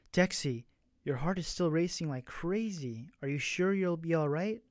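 plain reading voice

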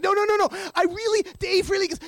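high, urgent protest; re-enacted frantic insisting. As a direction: plead your case and get cut off. Arguing with door staff.

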